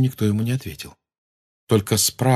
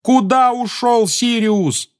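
high, loud slow yell; 5 dB louder, slower than usual. A narrator's reading. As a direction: yell after the elf. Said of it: "завопил Гарри".

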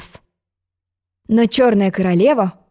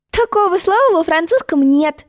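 confident; 9 semitones higher than usual. Acted. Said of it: "уверенно заявила".

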